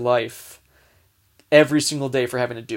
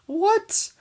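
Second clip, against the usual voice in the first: shocked what; 3 dB louder than usual. Retold or imitated. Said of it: "I'm like".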